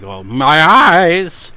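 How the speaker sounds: loud, slow, hammed-up wail; mock anguished cry. Mocking the eyeless ghost girl with a hammed-up, slow wail. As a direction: ham up the ghost's pain, stretched out. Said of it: "she was like".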